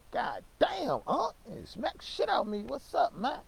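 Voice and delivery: high, startled yelp; jolted awake and confused. Retold or imitated.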